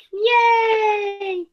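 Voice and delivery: high happy cheer; a bright, high little cheer. Acted. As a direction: celebrate the return. Bunnies are back.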